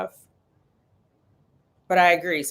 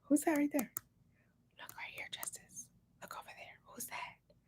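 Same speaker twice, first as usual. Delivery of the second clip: whisper; 10 semitones higher, 15 dB quieter than usual. Real, not acted. Mom whispering to Justice to look at the screen. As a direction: whisper to draw her eye.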